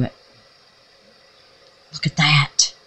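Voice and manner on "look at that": soft awed whisper; hushed whisper. Real, not acted. Showing viewers the hidden heart, awed whisper. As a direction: breathe it out in wonder.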